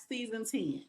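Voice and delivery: real country Virginia accent. Real, not acted. Host welcoming guest to season ten, country accent slipping out. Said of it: "I heard that accent when I said it".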